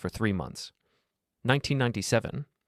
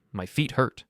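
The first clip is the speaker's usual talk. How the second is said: usual speaking voice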